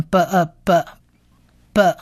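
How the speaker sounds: b呃b，b blended slowly into "buh"; sounding out b. Shown as a demonstration. Teacher joins consonant and vowel, slow "buh" demo. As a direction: build b plus 呃 into "buh", slowly. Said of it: "所以就变成".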